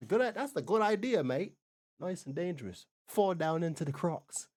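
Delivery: hammed-up, badly faked Australian accent; terrible Australian accent. Reactor mocking Australians over the gondola idea, terrible Australian accent. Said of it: "That's a terrible Australian accent".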